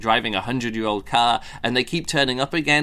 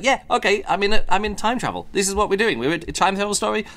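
higher, brisk can-do voice; eager acceptance; 8 semitones higher, faster than usual. Retold or imitated. Reviewer voicing a hero who just accepts time travel, higher and upbeat. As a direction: cheerfully jump into the story. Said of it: "Just like".

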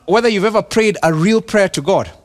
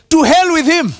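mock curse; 10 semitones higher, 4 dB louder than usual. Pastor voicing David's curse.

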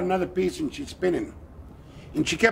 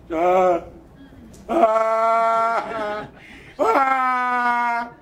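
crying wails; 4 semitones higher, much slower than usual. Acted. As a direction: wail like a lost child. Lost again, crying in dark.

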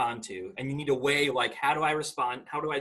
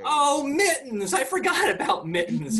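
laughing; 11 semitones higher, 6 dB louder, slower than usual. Real, not acted. Laughing at the Mittens nickname.

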